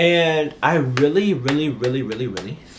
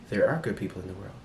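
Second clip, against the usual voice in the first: low, hushed, quicker delivery; soft Wonka line; 5 semitones lower, 9 dB quieter, faster than usual. Retold or imitated. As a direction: say it softly, imagining Wonka. Voicing Wonka's kindly closing line.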